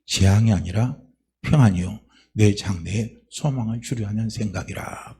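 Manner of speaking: low, loud scripture recitation; solemn scripture reading. Real, not acted. Pastor reading Jeremiah to the church, solemn and loud. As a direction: read the verse with gravity.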